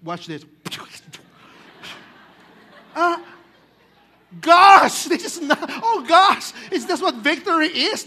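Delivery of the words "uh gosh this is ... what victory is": shocked outcry. Acted. Churchgoer shocked by the miracle.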